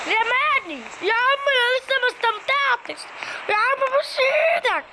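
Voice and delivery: high, slow, pleading complaint; high protesting whine. Real, not acted. She complains to the operator that he dropped her, pleading.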